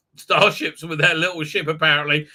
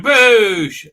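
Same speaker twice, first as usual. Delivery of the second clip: mouthed blaster shot; 5 semitones higher, 7 dB louder than usual. Retold or imitated. Imitating a gun blast.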